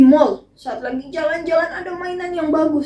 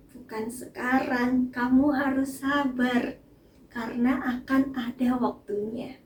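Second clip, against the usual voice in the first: gentle voice of God; 3 semitones lower, 9 dB quieter than usual. Acted. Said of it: "Tetapi ternyata Tuhan bilang".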